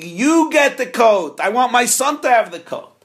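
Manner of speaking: father screaming. Acted. Father screaming that his son take the coat. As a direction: shout it at the son. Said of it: "the father is screaming".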